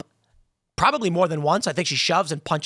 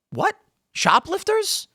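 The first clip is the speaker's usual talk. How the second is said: much higher, rising disbelief on Shoplifters; incredulous; 12 semitones higher, slower than usual. Retold or imitated. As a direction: react as if the idea is absurd. Listener shocked by absurd policy.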